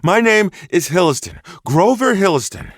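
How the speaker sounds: high, loud exasperated huffing; dramatic huff. Acted. Hunter huffs at the sheriff's request to start over, dramatic and loud. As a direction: sigh it out like a put-upon diva. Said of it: "he huffed with added drama".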